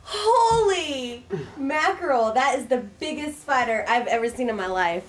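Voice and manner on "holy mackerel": ordinary talk, nothing marked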